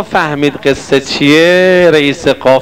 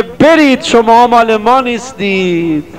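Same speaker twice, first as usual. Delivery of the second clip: Imam's rebuke; 6 semitones higher than usual. Retold or imitated. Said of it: "امام رضا بگه".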